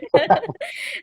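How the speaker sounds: a quick high burst of laughter; short laugh. Real, not acted. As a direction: laugh it off, pleased. Laughs after being praised. (ટૂંકું ખુશીભર્યું હાસ્ય)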